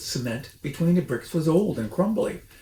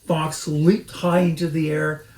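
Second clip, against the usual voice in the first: usual speaking voice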